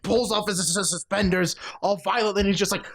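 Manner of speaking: ordinary delivery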